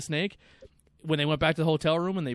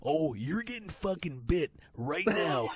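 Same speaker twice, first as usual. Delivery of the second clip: lightly voiced Macho Man, slowing then barking higher; Macho Man impression; 3 semitones higher than usual. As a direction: wrestler ordering Jake to pull out his leg, mock menace. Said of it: "like fucking Macho Man".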